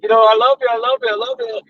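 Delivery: mock doggy adoration, voice lifted; happy dog. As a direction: gush like a dog overjoyed to see you. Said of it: "as soon as you walk in the door, they're like".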